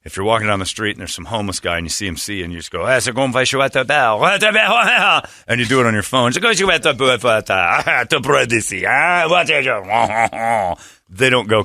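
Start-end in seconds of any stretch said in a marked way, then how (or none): 2.9-10.8 mock French accent, drawn out; fake French. Hammed-up fake French accent to scare off a beggar. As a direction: babble fake French at the homeless guy. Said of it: "speaking tons of fake French".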